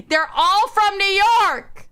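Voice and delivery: high, emphatic cry after a laugh; exasperated exclamation. Real, not acted.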